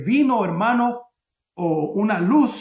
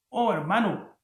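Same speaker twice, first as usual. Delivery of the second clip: ordinary talk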